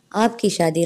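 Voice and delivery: ordinary delivery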